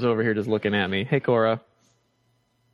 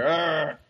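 brief demonstration growl, pitched up; Bigfoot sound; 4 semitones higher than usual. Shown as a demonstration. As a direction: model a Bigfoot growl for a child. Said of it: "One more, one more".

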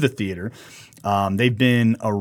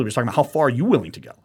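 ordinary delivery

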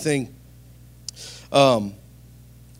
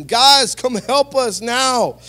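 calling out; 8 semitones higher, 5 dB louder, much slower than usual. Acted. Calling partners for help.

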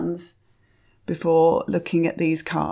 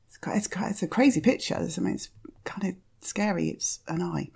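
whispered aside; 6 dB quieter than usual. Real, not acted. Whispered reaction to a creepy picture.

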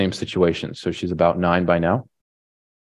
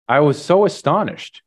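ordinary talk

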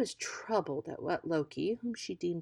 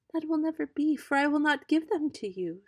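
much higher, firm protest; defiant refusal; 10 semitones higher, 4 dB louder than usual. Acted. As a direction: Iduna refusing the giant outright. Iduna refuses the giant.

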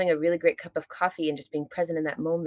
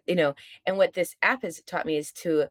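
ordinary delivery

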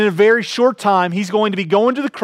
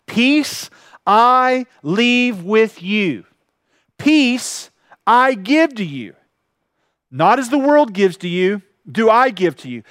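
slow, solemn reading of the verse; measured pulpit scripture reading; slower than usual. Acted. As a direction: read each phrase slowly and let it land. Reads verse twenty-seven slowly.

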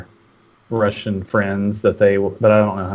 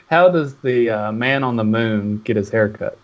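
telling a dad joke. Acted. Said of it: "I do have a, uh, a dad joke".

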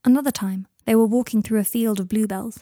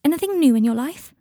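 higher, lightly chirpy query with a lilt; chirpy question; 6 semitones higher, 3 dB louder than usual. A narrator's reading. Friend opening an intervention, mildly chirpy. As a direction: ask it with casual cheer. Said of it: "asked chirpy questions about his job".

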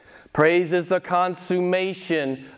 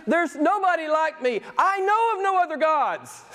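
very high-pitched divine declaration, easing down at the end; God; 8 semitones higher than usual. Acted. Preacher voicing God in Isaiah to congregation, high declaration descending at the close. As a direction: proclaim it high, as God claiming sole place. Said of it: "I love it when he talks like this".

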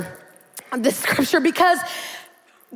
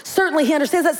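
normal talking voice

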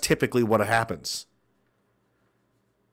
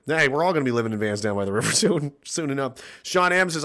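usual speaking voice

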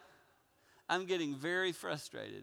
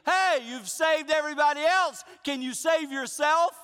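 high, loud shout up at someone; yelled taunt; 10 semitones higher, 8 dB louder than usual. Acted. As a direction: heckle loudly from a crowd, acted. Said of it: "a guy yelled up at me".

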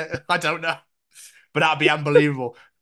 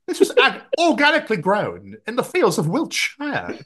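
lightly hammed-up food advert voice; mock produce advert; 8 semitones higher than usual. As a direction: proudly present farm produce, higher pitched.

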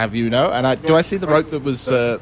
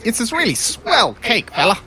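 higher, slow, smarmy pitch; mock salesman; 8 semitones higher than usual. Player helping persuade the guard, slow and higher. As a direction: oversell the cake with a grin. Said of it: "can I help him?".

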